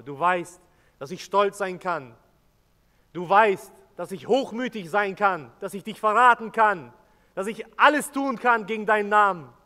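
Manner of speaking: rising to a passionate shout; impassioned outcry. Acted. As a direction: throw the confession out at full voice. Peter's impassioned confession, building.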